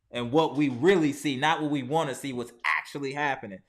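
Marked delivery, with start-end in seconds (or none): none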